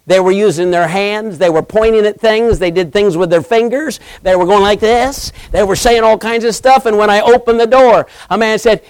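usual speaking voice

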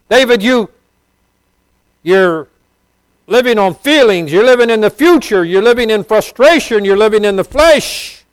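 preacher calling David out loud and high. Acted. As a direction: rebuke David in a ringing high voice.

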